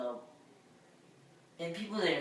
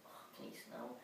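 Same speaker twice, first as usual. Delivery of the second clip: inner thought whisper; 14 dB quieter than usual. Retold or imitated. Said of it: "in my head I was thinking".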